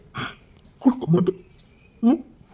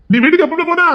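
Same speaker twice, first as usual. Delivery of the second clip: surprised; 12 semitones higher, 7 dB louder than usual. Acted. Surprised question to a colleague.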